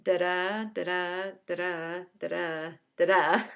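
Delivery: five separate "da-da" beats, stressed on the second DA; iambic pattern. Shown as a demonstration. Teacher demonstrating the pattern in the line, iambic pattern. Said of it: "they're in this pattern of".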